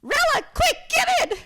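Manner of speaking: very high, very loud holler; full-volume retold getaway shout; urgent yell. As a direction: yell to a friend to hurry. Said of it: "And she yells".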